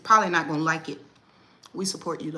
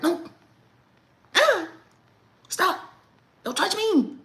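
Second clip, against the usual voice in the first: high, loud dramatic yelp; 12 semitones higher, 5 dB louder, slower than usual. Shown as a demonstration. Mom dramatically yelping at her son. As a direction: overplay the hurt. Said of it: "you gotta be dramatic too".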